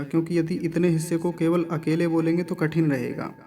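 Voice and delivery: ordinary talk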